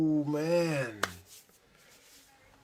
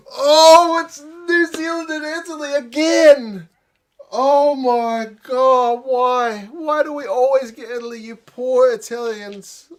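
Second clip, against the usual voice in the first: gasp, then a much higher, louder, slowed disbelieving cry; shocked outcry; 10 semitones higher, 10 dB louder, slower than usual. Real, not acted. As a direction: cry out in real disbelief at the draw. Shocked reaction to draw result.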